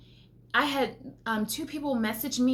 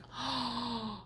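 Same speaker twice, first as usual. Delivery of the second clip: gasp; 10 dB quieter than usual. Real, not acted. Sees the dollar sign.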